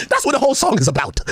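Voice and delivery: ordinary talk, nothing marked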